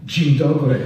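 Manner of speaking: put-on a mangled Australian-accented "Dzień dobry", loud and flat; mock a friend's clumsy Australian Polish; tortured Australian accent. Teasing an absent friend's Australian-accented Polish greeting. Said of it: "in his tortured Australian accent".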